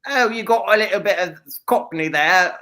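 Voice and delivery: little Cockney. Pretend. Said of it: "give me some little Cockney".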